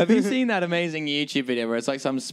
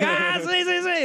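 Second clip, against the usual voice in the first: much higher squeaky yes-yes; a caller who finally gets it, squealing; delighted caller; 13 semitones higher than usual. Retold or imitated. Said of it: "the guy's like".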